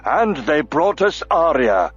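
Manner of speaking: indignant, firm defense; bristling dwarf. A narrator's reading. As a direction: push back hard. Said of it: "Auric bristled".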